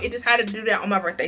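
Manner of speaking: ordinary talk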